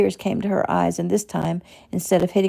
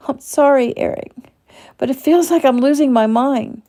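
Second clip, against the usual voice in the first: sobbing apology; 8 semitones higher, 5 dB louder than usual. Acted.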